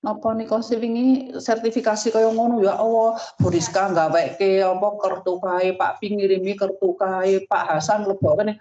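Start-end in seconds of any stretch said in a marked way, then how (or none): none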